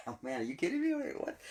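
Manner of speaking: a laugh breaking into incredulous squeaks; laughing disbelief. Retold or imitated. Abraham laughing at God's promise, in amused disbelief. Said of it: "he laughed to himself in disbelief".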